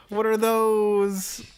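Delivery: high, slow meme delivery. Acted. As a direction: drag it out like the viral meme. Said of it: "Wrong meme, [name]".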